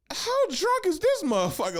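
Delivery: high-pitched mock disbelief; squeaky incredulous reaction. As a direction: act out stunned disbelief, voice shooting up. Acts out his shocked reaction.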